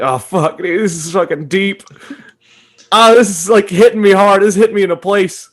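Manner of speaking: retelling being deeply moved, voice rising high; moved outburst. Retold or imitated. Telling a friend how the film hit him, worked up. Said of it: "And I'm like".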